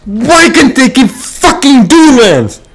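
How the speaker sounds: high, loud raging rant; furious ranting yell. Real, not acted. Gamer ranting at the game's design, furious yelling. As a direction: scream your grievances at the game.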